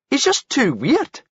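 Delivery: high, slow amused voice; drawn out and high. Acted. Paul explaining Spammy.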